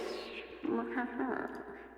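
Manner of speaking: belch it out; burp. Real, not acted. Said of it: "Don't mind me".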